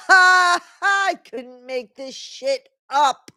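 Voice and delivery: slow, high words through laughter; real laughter breaking the words; laughing hard. Real, not acted.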